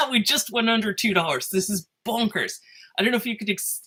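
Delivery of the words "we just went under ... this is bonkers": sudden excited outburst; real excitement. Real, not acted. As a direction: break off, thrilled and incredulous at the news. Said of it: "you could hear how excited I am".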